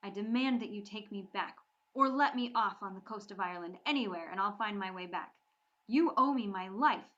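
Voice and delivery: loud, forceful demand; fiery demand. Acted. Bree demanding to be taken home, angry. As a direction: insist with burning anger. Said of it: "Bree's anger was like a fire".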